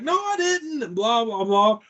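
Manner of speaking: hammed-up high-voiced denial; mock yelling. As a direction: fire back a high denial. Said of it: "yelling at each other".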